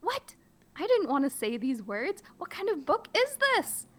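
very high, squeaky outrage; hammed-up shock. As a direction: shriek in disbelief. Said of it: "play it up and be funny".

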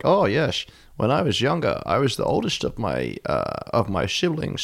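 put-on slushy Dutch-tinged old man's accent; comic Dutch accent with a lilting start; ex's Dutch-Australian grandfather. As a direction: imitate an elderly Dutch emigrant to Australia. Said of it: "he has the maddest accent".

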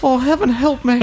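fluttery mock Southern belle accent. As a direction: gasp it like a Gone with the Wind Southern belle. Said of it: "talk like, what's her face from Gone with the Wind".

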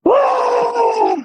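sudden very high panicked yelp; a shocked squeak, caught out; startled yelp. Retold or imitated. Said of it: "I am just like".

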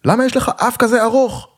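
high-pitched mock screech; screaming taunt. A narrator's reading. Small punk in a Berlin club taunting a dancer, screaming. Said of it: "עם בלורית צורח עליי".

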